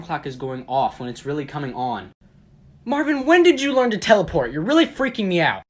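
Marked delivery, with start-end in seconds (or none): 2.8-5.6 high, loud startled cry; startled shout. Acted. Friend spooked by Marvin's teleport, shouting in alarm. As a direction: yelp it like he just vanished.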